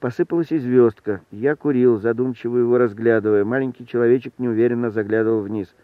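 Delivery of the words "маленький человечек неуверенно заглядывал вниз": ordinary delivery, nothing marked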